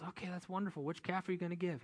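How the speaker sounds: a hint of a pleased wife asking; wife's voice. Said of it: "And she goes".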